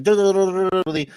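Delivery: rapid-fire Puerto Rican gibberish, endings left off; mock Puerto Rican Spanish. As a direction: rattle it off like a Puerto Rican machine gun. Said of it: "they're like machine guns when they speak Spanish".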